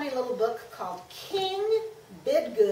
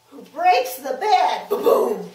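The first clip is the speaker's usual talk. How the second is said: loud crash; 12 dB louder than usual. A narrator's reading. Bed crashes.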